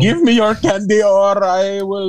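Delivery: high, hammed-up Indian accent; comic Indian-accented trick-or-treater; put-on Indian accent. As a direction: demand it in a mock Indian accent. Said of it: "we're trying to do the accent".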